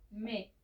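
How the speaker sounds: "me" with the final S left silent. Shown as a demonstration. Teacher showing the French plural, silent final S. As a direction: say "me" with no S at the end. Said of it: "la S final no se pronuncia".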